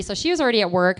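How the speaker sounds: ordinary talk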